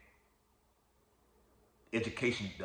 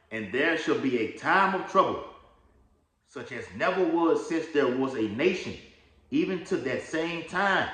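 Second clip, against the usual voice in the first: scripture reading; 5 semitones higher than usual. Acted. Reads Daniel aloud as scripture.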